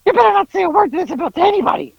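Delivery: high, fast imitation of a warning; mock warning. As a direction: warn in a squawky put-on voice.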